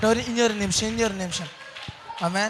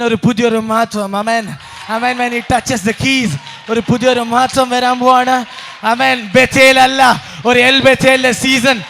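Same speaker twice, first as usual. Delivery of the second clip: loud revival proclamation building in fervour; full-force preaching; 3 semitones higher, 12 dB louder than usual. Real, not acted. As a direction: shout the blessing over the whole church.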